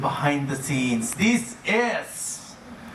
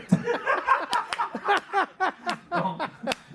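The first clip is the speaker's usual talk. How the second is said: big laugh. Real, not acted. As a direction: burst out laughing high. Laughing at wrong door.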